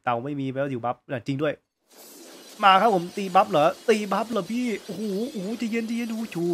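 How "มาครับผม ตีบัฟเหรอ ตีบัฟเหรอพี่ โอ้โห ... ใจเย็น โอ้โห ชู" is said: much higher, louder panicked chatter; frantic excitement. Real, not acted. Gamer panics as enemies jump him at the buff, high and frantic. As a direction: gasp and plead in the heat of the fight.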